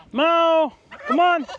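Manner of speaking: high, loud call to the dog. Real, not acted. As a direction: call him from across the yard. Calling the dog.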